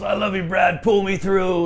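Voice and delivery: high-pitched imitation of a rock singer; pitched well up, mock heartfelt. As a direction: imitate a star declaring it to his guitarist. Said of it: "he stands up".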